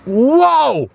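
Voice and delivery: high, loud amazed exclamation; surprised whoa. Real, not acted. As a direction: blurt it out in amazement. Reacting to taste while eating.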